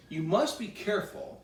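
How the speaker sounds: plain reading voice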